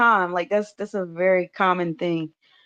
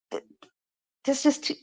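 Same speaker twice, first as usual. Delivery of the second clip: ordinary talk, nothing marked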